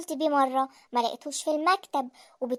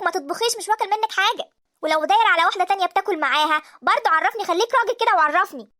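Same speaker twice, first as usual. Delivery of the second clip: loud, high-pitched tirade; furious shouting; 4 semitones higher, 7 dB louder than usual. Acted. Wife explodes at husband eating out, furious shouting. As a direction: let rip at him at full volume.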